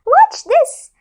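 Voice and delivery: high excited little voice; eager fish. Acted. Tiniest fish showing off to cheer the moon, excited. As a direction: show off brightly.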